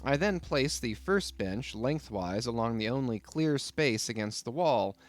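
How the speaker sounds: ordinary delivery, nothing marked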